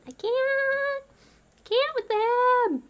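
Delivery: very high, drawn-out squeal; mock exasperated wail. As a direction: whine it high and slow in mock despair. Overwhelmed mock despair at them.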